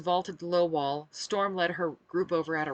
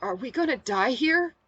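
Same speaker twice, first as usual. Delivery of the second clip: higher, louder, mildly fearful question; shaky question; 8 semitones higher, 6 dB louder than usual. A narrator's reading. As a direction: ask with a touch of acted fear. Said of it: "a man asked shakily".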